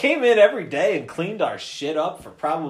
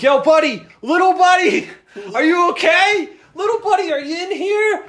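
much higher, louder calls breaking into laughter; calling out; 13 semitones higher, 6 dB louder than usual. Retold or imitated. Searching the smoky bathroom for his friend, high laughing calls. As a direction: call for your little buddy while laughing.

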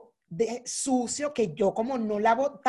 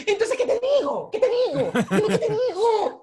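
high, laughing mock wail; exasperación teatral; 11 semitones higher, 3 dB louder than usual. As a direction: plead in exaggerated exasperation, high-pitched. Se recrea exasperada ante su pareja. (súplica exasperada y exagerada)

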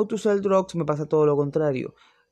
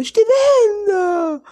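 much higher, louder, overacted crying plea; mock sobbing; 9 dB louder, much slower than usual. Mocking the Italian actress's overdone teary line, high and sobbing. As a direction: ham up a teary melodramatic daughter. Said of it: "yo medio llorando".

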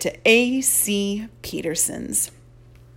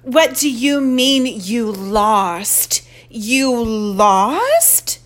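sharp, slow, disbelieving scold; a mother scolding her daughter, slow and loud; 2 semitones higher, 5 dB louder, much slower than usual. Acted. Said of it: "A loud, sharp voice".

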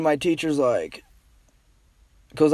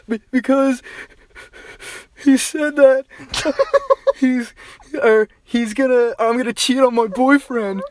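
put-on high crying girl; 7 semitones higher than usual. Crying girl explains to dean.

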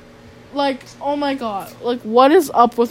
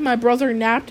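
ordinary talk

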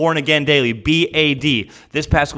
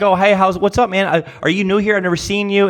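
friendly greeting; 7 semitones higher than usual. Shown as a demonstration. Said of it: "But at least be like".

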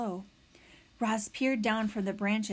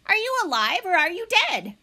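higher, louder call from a tree; 10 semitones higher, 6 dB louder than usual. Acted. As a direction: call it down from above. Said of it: "The robot's voice called down from the tree".

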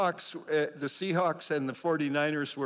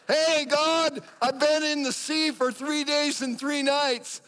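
high, loud, hammed-up holler; calling out to God; 10 semitones higher, 5 dB louder, slower than usual. Paul reporting his days at sea to God, high loud call. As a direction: holler up to heaven.

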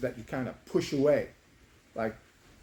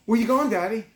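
put-on higher, louder little-kid question; lightly imitated little girl, pitched up; young daughter; 9 semitones higher, 7 dB louder than usual. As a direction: call out to dad as a small child. Daughter voiced in a story.

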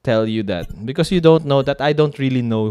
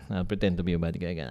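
usual speaking voice

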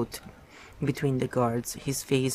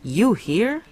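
startled, high and sharp; sudden startled question; 8 semitones higher, 4 dB louder than usual. A narrator's reading. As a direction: blurt it out in shock. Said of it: "startled into self-forgetfulness".

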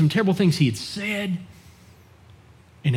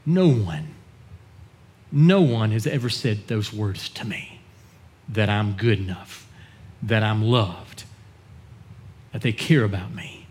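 emotional; 6 semitones lower, 4 dB quieter than usual. Retold or imitated. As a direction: retell it heavy with emotion, pausing between phrases. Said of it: "in a very emotional voice".

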